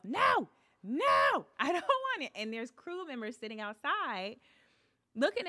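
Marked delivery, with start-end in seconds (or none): 0.0-2.3 high, loud panicked refusal; retold yelling. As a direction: yell it toward the house, high-pitched. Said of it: "yelling at the house".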